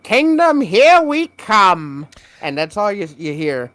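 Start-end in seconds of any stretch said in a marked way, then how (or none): 0.0-2.1 high, slow, drawn-out sidekick cheer; Toad. Retold or imitated. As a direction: announce it like a cartoon sidekick, stretched out. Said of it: "And he's like".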